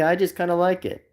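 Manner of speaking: ordinary talk, nothing marked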